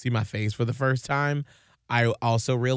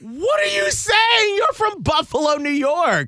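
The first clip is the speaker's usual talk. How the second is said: exasperated disbelief; 4 dB louder than usual. Retold or imitated. Retelling a rebuke to boyfriend's Southern accent, exasperated shout. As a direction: shriek it in mock exasperation. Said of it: "And I'm like".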